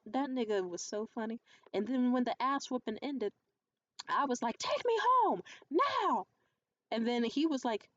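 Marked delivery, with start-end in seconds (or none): 4.6-6.3 loud, high-pitched retold yell; re-enacted angry outburst at full volume; acted shouted demand. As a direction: yell it as an order, drawing out the last words. Retelling a furious demand after fight.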